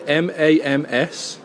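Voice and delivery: slow spelling, letter by letter; dictating. Acted. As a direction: spell each letter out clearly. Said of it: "I'll spell it out to you".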